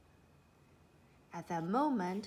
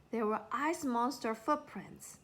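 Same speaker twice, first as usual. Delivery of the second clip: ordinary delivery, nothing marked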